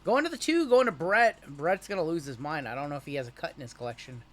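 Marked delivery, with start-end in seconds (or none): 0.0-1.5 excited. Real, not acted. Announcing card hit on stream.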